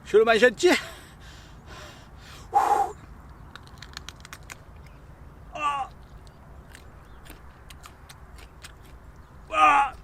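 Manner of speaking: strained high voice, mouth on fire; talking through chili burn; mouth burning from spicy food. Real, not acted. Eating whole chili pepper.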